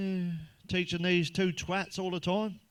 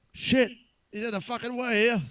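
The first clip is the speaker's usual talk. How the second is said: louder, higher driver yelling at the van; annoyed shout; 7 semitones higher, 4 dB louder than usual. Retold or imitated. A driver stuck behind a van, shouting in mock rage.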